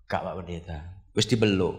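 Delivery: low, soft retold answer; reply docilely under the breath; meek patient. Patient assuring the pastor he is hugged, meek and soft.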